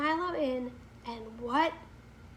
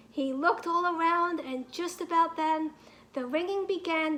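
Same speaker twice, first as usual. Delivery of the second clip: usual speaking voice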